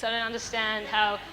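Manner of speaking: normal talking voice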